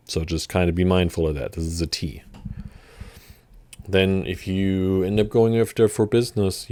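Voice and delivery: ordinary delivery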